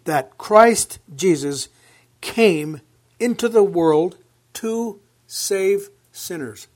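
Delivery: measured, ringing Bible reading; proclaiming scripture from the pulpit. Acted. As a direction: read the verse out to the congregation. Reads scripture aloud.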